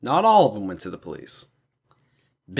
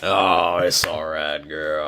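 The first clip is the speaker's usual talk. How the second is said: Arthur Morgan; 8 semitones lower, slower than usual. Acted. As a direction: drop into a low voice to soothe. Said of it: "That was a good Arthur Morgan".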